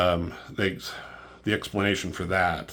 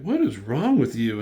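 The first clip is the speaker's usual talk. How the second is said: retold exasperation; 5 semitones higher, 5 dB louder than usual. Acted confrontation.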